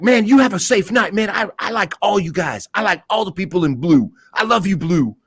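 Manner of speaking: high sing-song friendly goodbye; mock street guy. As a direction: gush at the officer to smooth things over. Voicing the guy's friendly goodbye.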